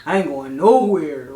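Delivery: low, slow mock of the guy from Jersey; imitating his slow drawl. Retelling how the guy from Jersey said it, low drawl. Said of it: "The way he said it".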